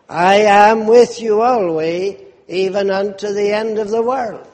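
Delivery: voicing the Savior. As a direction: quote the Savior with gentle solemn lift. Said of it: "here the Savior says".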